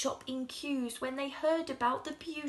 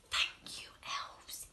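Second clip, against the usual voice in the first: soft, breathy whispered thanks; grateful hushed whisper to the elves; 5 dB quieter than usual. Acted. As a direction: whisper it at the window. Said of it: "he whispers".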